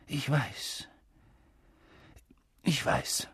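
hushed, choked-up consolation; husky with emotion. A narrator's reading. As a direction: say it softly through a thickened throat. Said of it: "sagte er mit belegter Stimme". (mit belegter Stimme, leise)

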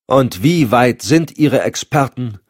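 slow, high, mocking question; scornful undertone. A narrator's reading. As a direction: needle them with drawn-out derision. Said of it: "mit höhnischem Unterton".

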